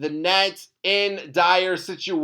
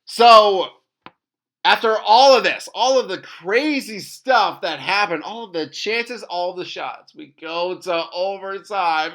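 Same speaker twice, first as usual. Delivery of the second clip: ordinary talk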